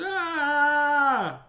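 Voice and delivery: high, loud yell of fright; startled yell. A narrator's reading. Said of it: "his father turned around and yelled".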